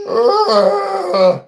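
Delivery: put-on a sudden high, loud yowl like an old dog; imitated dog yowl. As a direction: bark out a shrill dog yowl. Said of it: "he's like".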